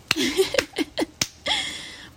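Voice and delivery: a burst of high-pitched laughter. Real, not acted. Laughing at own pettiness.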